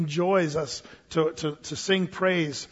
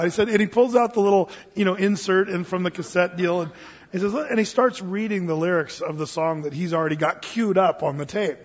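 ordinary talk, nothing marked

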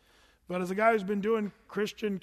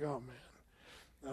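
quiet groan; 6 semitones lower, 14 dB quieter than usual. Retold or imitated. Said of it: "I'm just kinda like".

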